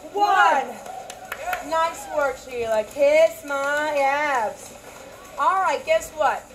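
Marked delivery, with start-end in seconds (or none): 2.8-4.7 very slow, stretched playful line; drawn out. Real, not acted. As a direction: drag it out for the joke. Drawn-out pun on earlier line.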